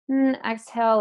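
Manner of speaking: ordinary talk, nothing marked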